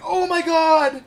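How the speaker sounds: loud, high, slow "oh my god"; sudden realization. Retold or imitated. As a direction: gasp it out as pieces click. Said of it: "when she stops and is like".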